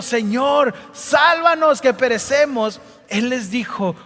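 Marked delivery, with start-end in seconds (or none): 0.0-2.8 terrified cry for help. Acted. Disciples in the storm shouting at sleeping Jesus, desperate.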